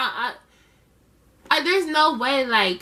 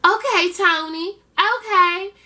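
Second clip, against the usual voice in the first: Angela giving in; 7 semitones higher, 4 dB louder, slower than usual. Retold or imitated. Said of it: "And Angela's like".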